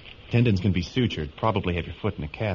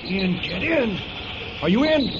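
urgent shouting. Acted. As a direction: shout her into the truck. Boy yells during the escape.

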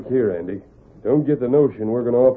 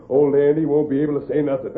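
ordinary talk, nothing marked

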